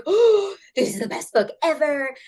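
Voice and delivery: high gushing "Oh" then bubbly; excited kid gushing. Retold or imitated. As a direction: gush like a thrilled sixth grader. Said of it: "and I was like".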